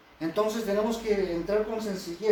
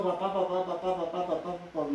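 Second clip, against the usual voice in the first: put-on a child droning papá over and over, fading softer; mock pestering kid, trailing off; whiny repeated papá, dying away; nagging child repeating; 4 dB quieter than usual. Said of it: "si empezamos a hablar así".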